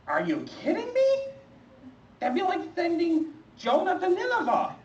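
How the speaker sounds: high, squeaky are-you-kidding-me; incredulous protest. Acted. Arguing with God about preaching: high incredulous protest. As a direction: protest in comic high disbelief. Refusing God's request.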